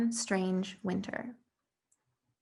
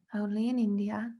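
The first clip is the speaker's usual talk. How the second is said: usual speaking voice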